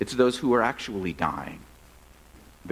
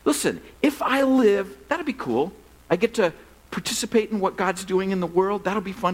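excited paraphrase; 6 semitones higher than usual. Retold or imitated. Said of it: "What he's saying is like".

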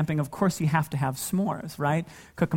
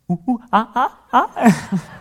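put-on higher, playful child oohing; kid's ooh and ah; 5 semitones higher, 6 dB louder, slower than usual. Dad imitates his son oohing at fireworks, high and playful. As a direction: copy a little boy's fireworks oohs. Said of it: "he goes".

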